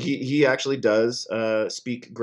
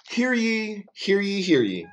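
town crier; 9 semitones higher than usual. Pretend. Mock proclamation opening.

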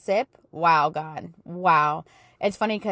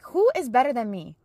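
raised, brighter voice, building joy; excited praise; 4 semitones higher than usual. Retold or imitated. Praising God in the kitchen, getting excited. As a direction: ask it like a happy boast.

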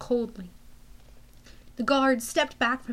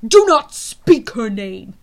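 loud stern command; 12 dB louder, slower than usual. Acted. General forbids the name.

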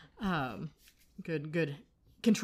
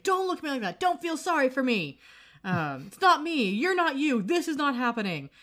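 retold upset outburst, high and emphatic; super upset; 8 semitones higher, 5 dB louder than usual. Upset Quentin tells his friend not to pity him, high upset protest. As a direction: protest in a higher, insistent voice. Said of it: "like, super upset".

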